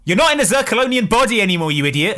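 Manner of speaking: loud, fast, exasperated outburst; exasperated shout. Real, not acted. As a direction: yell it at the screen. Scolding the character in exasperation.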